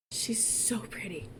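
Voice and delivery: slow, whispered adoration; awed whisper. Real, not acted. Gamer marvels at her Jasmine creation, awed whisper. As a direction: barely voice it, overcome by admiration.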